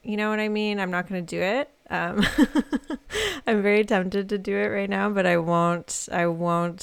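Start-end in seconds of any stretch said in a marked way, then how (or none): 2.3-3.4 high, rapid giggle. Real, not acted. Radio host tickled at the idea of singing emo, giggling. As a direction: burst of high-pitched laughter.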